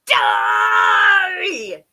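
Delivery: long, roaring furious shout. A narrator's reading. Exasperated wicked fairy to the deaf one, shouting. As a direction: bellow it, stretching the vowel out. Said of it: "shouted the garlicky one".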